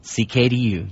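ordinary talk, nothing marked

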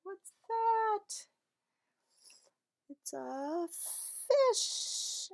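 surprised reveal. Acted. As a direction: sound delighted at the find.

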